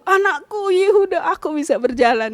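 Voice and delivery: seruan haru yang lantang, mulai tinggi lalu turun; memanggil anak. Retold or imitated. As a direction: panggil anakmu untuk mengabarkan mukjizat. Menirukan seruannya memanggil anaknya. (berseru kepada anak dengan haru)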